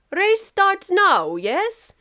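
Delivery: high, slow and loud; Russia voice. Acted. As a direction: draw out each word like a big drunk bear.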